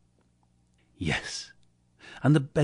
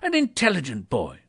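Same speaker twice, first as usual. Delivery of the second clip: delighted Scrooge; 6 semitones higher than usual. A narrator's reading. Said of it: "said Scrooge".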